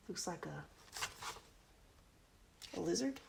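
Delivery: ordinary delivery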